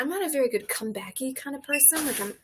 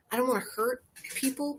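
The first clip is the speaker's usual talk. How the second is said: usual speaking voice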